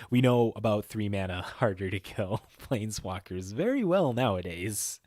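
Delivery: chuckling through the words; laughing. Real, not acted. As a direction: laugh mid-sentence at own joke. Laughing at own joke.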